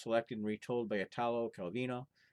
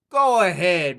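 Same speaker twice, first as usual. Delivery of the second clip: loud, higher careless shout; bold shout; 7 semitones higher, 16 dB louder, slower than usual. Acted. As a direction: call up the chimney without fear. Fearless lad shouts up chimney.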